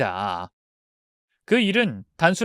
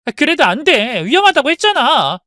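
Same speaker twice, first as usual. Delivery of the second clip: louder, higher firm refusal; angry insistence; 10 semitones higher, 8 dB louder than usual. Acted. Daughter refusing her father's job, louder angry insistence. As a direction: refuse him outright.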